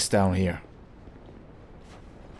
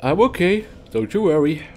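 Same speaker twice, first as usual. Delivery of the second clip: loud reassurance; 7 semitones higher, 6 dB louder than usual. Real, not acted. Reassuring audience after escape.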